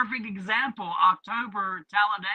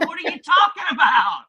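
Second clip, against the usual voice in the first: высокий, громкий, со смехом неверие; incredulous; 8 semitones higher, 5 dB louder than usual. Retold or imitated. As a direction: laugh through an incredulous question. Retelling his disbelief.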